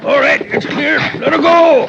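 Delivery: high, loud call across the rock slide. Acted. Calling the all-clear to son while moving rock, loud. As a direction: shout the all-clear up to the man.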